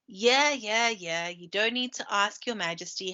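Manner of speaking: plain reading voice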